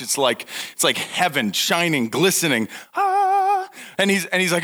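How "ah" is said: joyful shout. Acted. Heaven shining bathroom in sight.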